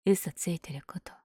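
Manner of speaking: soft whisper, a little sheepish; whispered admission. Acted. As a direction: breathe it out quietly. Admitting her lie.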